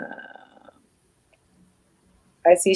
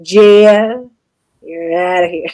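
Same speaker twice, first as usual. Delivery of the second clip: mock stern; 3 semitones lower, 6 dB louder, slower than usual. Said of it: "I'm just kidding".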